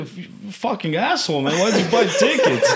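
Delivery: high, loud friendly outrage with laughter; affectionately telling off a mate; retold exasperation, breaking into laughter; mock scolding.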